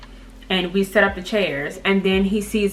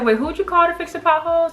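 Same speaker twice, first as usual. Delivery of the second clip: high, fast, disbelieving question; incredulous; 11 semitones higher, faster than usual. Retold or imitated. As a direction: catch him out in disbelief. Questioning date about potholes.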